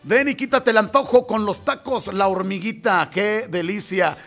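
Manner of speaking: upbeat taco spot; friendly commercial read; radio ad read. Acted. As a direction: pitch the tacos to listeners. Reads taco shop ad.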